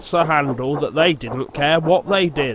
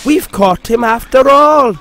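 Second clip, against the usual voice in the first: excited; 9 semitones higher, 9 dB louder than usual. Acted. Engine relieved and excited.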